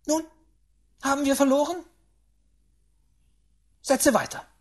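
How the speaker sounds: loud, high demands; impatient great-aunt. Acted.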